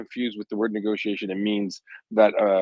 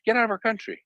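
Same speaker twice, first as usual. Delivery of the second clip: pitched high and quick, cutting off the word; emphatic demand; 13 semitones higher than usual. Retold or imitated. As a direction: fling it out high and fast like an order. Demanding Russia leave Ukraine.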